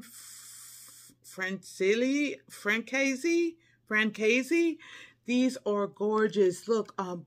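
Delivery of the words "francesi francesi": usual speaking voice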